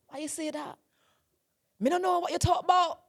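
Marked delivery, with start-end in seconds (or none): 1.8-3.0 high, loud Jamaican accent; attempted Jamaican accent. Shown as a demonstration. Another try for a friend, high Jamaican attempt. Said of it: "when I'm doing my Jamaican accent".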